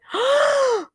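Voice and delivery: sharp high gasp of shock; retold shocked gasp. Mom and daughter shocked at dad's remark, high gasp. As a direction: gasp as if scandalised. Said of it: "my mom and I both went".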